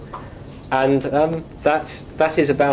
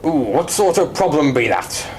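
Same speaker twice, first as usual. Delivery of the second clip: pirate voice taking a support call; play-acted pirate curiosity; pirate role-play. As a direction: ask it like a pirate on a helpdesk. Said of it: "to do pirate lingo".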